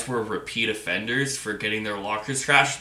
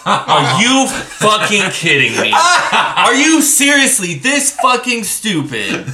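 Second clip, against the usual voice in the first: much higher and louder, laughing disbelief; incredulous outburst; 10 semitones higher, 10 dB louder, slower than usual. Retold or imitated. Sergeant to the clueless recruit, loud laughing disbelief. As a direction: blow up in disbelief, half laughing, slow. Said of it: "he's like".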